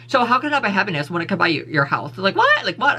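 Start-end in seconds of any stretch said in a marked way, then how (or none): 2.2-3.0 what leaps much higher in disbelief; incredulous. Real, not acted. Arguing money buys health, incredulous high what. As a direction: squeak out the question in disbelief. Baffled by her own argument.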